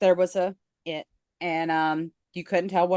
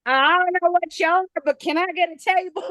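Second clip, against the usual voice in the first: lightly voiced old customer, higher pitched; mock blunt customer; 10 semitones higher, 4 dB louder than usual. Old man asking the host for a table, mock blunt. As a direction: retell a blunt retort, pitched well up.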